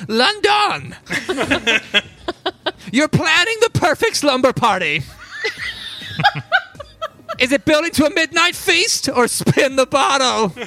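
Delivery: high, loud, laughing quiz host; quirky NPC voice. Acted. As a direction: play the giggly shopkeeper firing questions. NPC quizzes party with questions.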